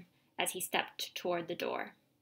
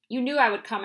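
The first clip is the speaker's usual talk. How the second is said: stern agent; 3 semitones higher, 8 dB louder, faster than usual. A narrator's reading. Agent confronts father.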